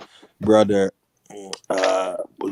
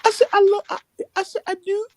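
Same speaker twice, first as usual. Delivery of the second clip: high, stammering fake sobs; mock crying; faster than usual. Retelling a man answering his girlfriend, mock crying. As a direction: imitate a man insisting through tears. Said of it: "he's literally crying".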